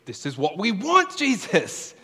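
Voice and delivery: high, loud, eager plea; high, loud voice of a disciple hoping for status. Retold or imitated. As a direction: beg Jesus excitedly like a hopeful disciple.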